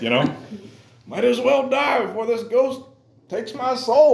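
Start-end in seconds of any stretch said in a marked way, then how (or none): 1.1-4.1 high, louder mock shriek; shrill panic. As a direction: wail like a frightened disciple. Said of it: "it means a shriek or a shrill".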